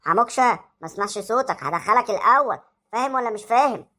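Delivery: low, threatening teacher voice; teacher scolding a noisy boy; stern teacher. Acted. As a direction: warn him you'll send him first.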